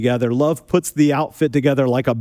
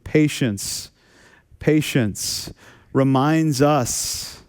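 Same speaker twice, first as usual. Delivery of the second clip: slow emphasis; much slower than usual. Real, not acted. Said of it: "slow emphatic sermon point".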